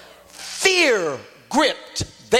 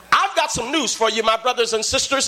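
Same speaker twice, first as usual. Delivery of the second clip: ordinary delivery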